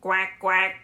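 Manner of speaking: quacking like a cartoon duck; playful duck quack; duck sound. Shown as a demonstration. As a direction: quack it out for the kids. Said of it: "That's my duck sound".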